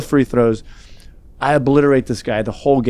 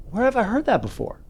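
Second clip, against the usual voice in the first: high, softer wondering voice; puzzled self-quote; 11 semitones higher, 5 dB quieter than usual. Retold or imitated. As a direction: wonder to yourself in a high, hushed voice. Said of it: "I'm walking by and I'm like".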